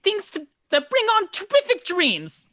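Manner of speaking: high, loud quick retort; snapped reply. Acted. She answers Six's question instantly in Infernal, snapping back. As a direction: snap it back without thinking. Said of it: "immediately snaps back".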